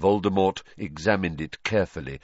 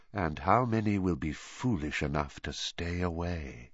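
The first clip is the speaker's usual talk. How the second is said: soft villain whisper; 3 semitones lower, 6 dB quieter than usual. A narrator's reading. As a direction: keep it hushed. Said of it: "he whispered".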